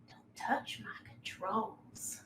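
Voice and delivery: slow, hushed monster threat; menacing creature. Acted. Towering creature rises from the water, slow whispered menace. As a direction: breathe the warning out slowly and softly. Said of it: "looks at you all and says".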